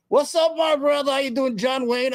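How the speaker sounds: mock greeting. Real, not acted. Taunting chat guest as John Wayne.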